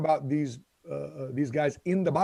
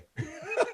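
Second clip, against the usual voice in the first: high, light burst of laughter; 13 semitones higher, 5 dB quieter than usual. Real, not acted. Host laughing at own lunch pick, high laugh.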